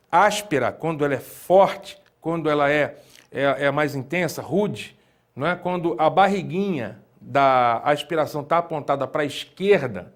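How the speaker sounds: usual speaking voice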